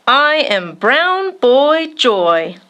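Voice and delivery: ordinary delivery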